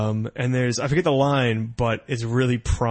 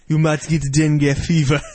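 badly imitated African accent; mock Nigerian accent; 2 semitones higher, 4 dB louder than usual. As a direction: warn like a Nigerian pastor, accent hammed up. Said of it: "I don't do a very good African voice".

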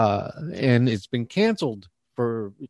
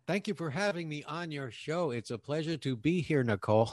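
softer, stiffly polite Zoom guest; mock-formal thank-you, held back and quieter; put-on guest formality; 3 semitones higher, 8 dB quieter than usual. Guest greets the host with Zoom etiquette, mock formal. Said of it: "Why are you talking like that?".

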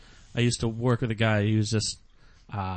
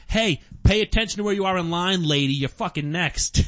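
put-on Boston accent; 5 semitones higher than usual. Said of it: "this super Boston girl was just".

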